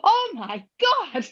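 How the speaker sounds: high, slow, stunned Oh my God; shocked friend. Retold or imitated. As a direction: gasp it out high and drawn out in shock. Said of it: "she's like".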